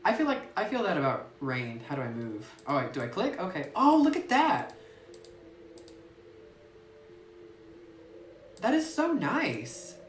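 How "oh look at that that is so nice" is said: delighted gasp. Real, not acted. Delighted by pretty game scene.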